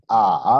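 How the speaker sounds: "A" dipping low, then rising; loud, drawn-out dipping "A"; third tone. Shown as a demonstration. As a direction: drop "A" down deep and bring it back up. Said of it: "baja un poquito y después vuelve a subir".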